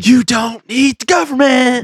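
high, slow, hammed-up proclamation; drawn-out mock announcer; mock slogan voice.

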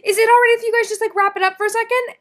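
mock sing-song friend asking them to wrap it up; imitating Jules knocking. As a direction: call out cheerily to the couple inside. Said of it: "and goes".

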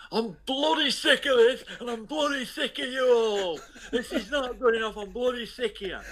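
mock an angry old Burton woman in the terrace; high furious Burton Midlands screech; Burton accent. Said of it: "in a thick Burton accent".

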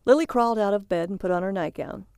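plain reading voice

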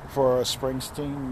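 usual speaking voice

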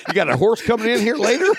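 high, fast, laughing joke; laughing quip. Retold or imitated. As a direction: crack the joke through a laugh. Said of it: "And I'm like".

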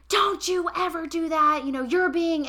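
imitating her husband snapping at her; mimicking husband's anger. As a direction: throw his words back at him. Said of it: "and he goes".

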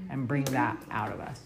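usual speaking voice

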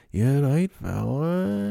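drawn-out, rising Manx drawl; exaggerated Manx greeting, vowels stretched; droney Manx accent. Shown as a demonstration. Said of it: "lower droney Manx accent".

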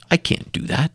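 imitating her whispered refusal; small whispered can't; fearful whisper. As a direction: hush it as if afraid. Woman refusing college, retold.

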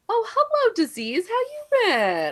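very high, sing-song mock greeting; mock greeting to disease. Greeting her own disease like an old friend, sing-song mock. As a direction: greet an old enemy sweetly. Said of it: "I'm always like".

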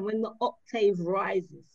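usual speaking voice

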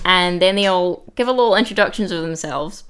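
ordinary talk, nothing marked

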